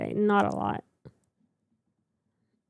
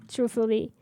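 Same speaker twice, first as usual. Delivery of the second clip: usual speaking voice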